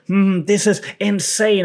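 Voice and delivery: high, slow hyped-up announcer; clickbait hype voice. Retold or imitated. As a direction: ham up a clickbait title. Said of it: "If you go like".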